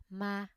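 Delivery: "ma" held level at mid pitch; "ma" in the level Vietnamese ngang tone. Shown as a demonstration. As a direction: say "ma" on an even mid tone, no rise or fall. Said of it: "mid-level tone or thanh ngang".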